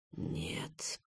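very low, barely audible groan; faint, anguished whisper; whispered moan. A narrator's reading. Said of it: "прошептал, простонал охранник".

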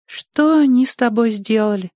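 put-on high girlish voice; small girl's high, plaintive question; concerned little girl. A narrator's reading. Girl finds the mauled Ogre, high and concerned. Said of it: "сказала она ему".

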